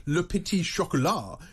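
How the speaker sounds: lightly put-on French accent. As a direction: say the biscuit name with a touch of French. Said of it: "with my French accent".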